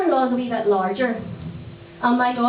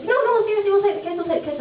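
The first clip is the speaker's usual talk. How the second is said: insisting in-laws voice; 9 semitones higher, faster than usual. Retold or imitated. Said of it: "in-laws insisting".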